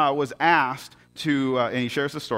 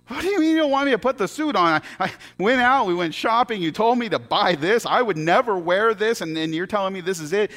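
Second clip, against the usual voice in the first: high, loud acted indignation; exasperated outburst; 10 semitones higher, 5 dB louder than usual. Logger told not to wear the suit, acted exasperated outburst. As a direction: explode in disbelief, retelling a furious complaint.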